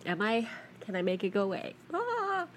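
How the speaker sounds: hushed, fighting off a sneeze; trying not to sneeze; holding back a sneeze. Real, not acted. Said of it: "Ugh, I'm gonna sneeze".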